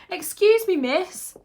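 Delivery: put-on high, loud little-boy voice; piping small boy. A narrator's reading. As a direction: pipe up high to get the teacher's attention. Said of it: "Owen piped up".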